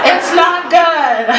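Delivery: high, very drawn-out warning; exaggerated warning. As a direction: stretch it out high for the crowd. Punchline, then repeated low.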